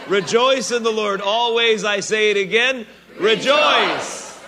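Paul's command, boomed from the pulpit; proclaimed with force. Shown as a demonstration. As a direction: call it out to the whole church. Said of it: "supposed to say rejoice".

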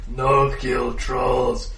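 slow loud dim troll. Acted. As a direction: bellow it slowly like a brutish troll. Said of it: "says one head".

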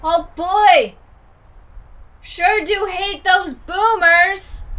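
high, loud, slow mock American holler; American call. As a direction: call across the distance in a hammed-up American accent. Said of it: "do an American call".

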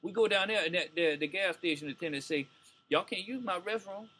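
put-on old Southern ballplayer voice; Buck O'Neil. Comic imitating a ballplayer reminiscing about a teammate, Southern old-timer voice. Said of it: "you can't portray Buck O'Neil talking like Buck O'Neil".

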